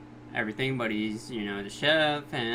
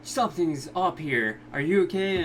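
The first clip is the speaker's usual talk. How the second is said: Josuke startled by his friend's tears, mock alarm; 5 semitones higher than usual. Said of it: "Josuke's like".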